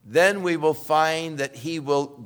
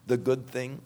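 usual speaking voice

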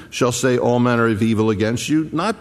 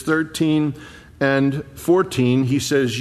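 normal talking voice

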